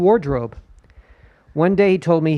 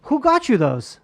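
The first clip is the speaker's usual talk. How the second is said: higher, prying wife voice; suspicious wife; 9 semitones higher than usual. Retold or imitated. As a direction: grill him like a jealous ex.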